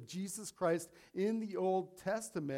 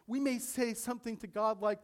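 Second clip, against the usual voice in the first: normal talking voice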